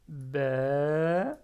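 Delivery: BA pulled long and loud with rising end, "bèèè"; drawn-out vowel. Shown as a demonstration. Teacher reads first syllable, BA held long, rising. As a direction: draw BA out, lifting at the end. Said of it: "je tire".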